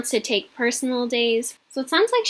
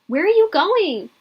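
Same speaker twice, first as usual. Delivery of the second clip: raised, high call across the house; 7 semitones higher, 6 dB louder than usual. A narrator's reading. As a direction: call after the running child. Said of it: "as she called out".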